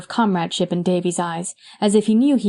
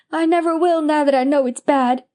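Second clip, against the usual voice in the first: put-on higher boyish vow, a touch of tears; between sobs; 7 semitones higher than usual. A narrator's reading. Davy promising Anne never to lie again, a touch tearful. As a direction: promise earnestly through light sobs. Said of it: "asseverated Davy between sobs".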